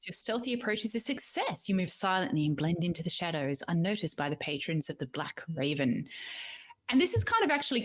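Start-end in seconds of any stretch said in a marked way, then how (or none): 0.0-6.1 game master narration. Acted. Reads the game master's scene.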